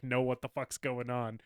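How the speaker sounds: usual speaking voice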